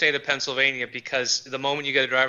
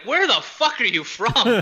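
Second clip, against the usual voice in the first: baffled locals; 9 semitones higher, 3 dB louder, slower than usual. Retold or imitated. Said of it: "people were like".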